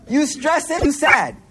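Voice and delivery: usual speaking voice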